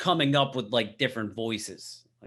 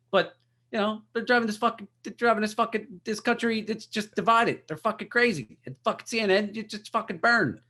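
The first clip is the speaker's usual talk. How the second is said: high, ranting comic-outrage voice pitched way up; Bill Burr impression; 11 semitones higher, 3 dB louder than usual. Podcaster imitating Bill Burr ranting at CNN, high and fired up. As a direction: rant like a worked-up stand-up comic. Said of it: "that wasn't the best Bill Burr".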